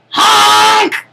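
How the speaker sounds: high, loud honking yes; loud clown honk. Acted. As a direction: blast a goofy honk. Clown answers loudly.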